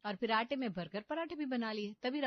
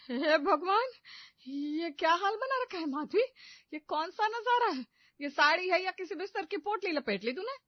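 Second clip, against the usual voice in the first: loud high shocked exclamation; aghast mother-in-law; 6 semitones higher, 5 dB louder than usual. Acted. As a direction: gasp in horror, high and loud. Shocked at badly wrapped saree.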